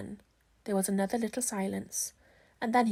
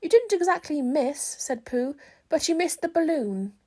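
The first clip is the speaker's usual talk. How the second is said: higher, louder bear voice, earnest; Pooh voice; 6 semitones higher, 5 dB louder than usual. Acted. Pooh tells Christopher Robin the bees are wrong. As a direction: announce it like a bear with a big decision. Pooh's voice, held.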